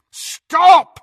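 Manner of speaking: very high, loud command; shout. A narrator's reading. Malfoy orders Crabbe to stop, echoing shout. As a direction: yell to halt him. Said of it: "Malfoy shouted at Crabbe".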